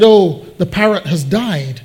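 usual speaking voice

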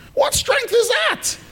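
very high, slow disbelieving question; high-pitched comic disbelief; incredulous squeal. Acted. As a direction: squeal it in mock astonishment. Rhetorical question, mock disbelief.

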